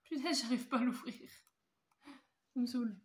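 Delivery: annoyed at the stuck case; frustrated. Real, not acted. Still cannot open the case.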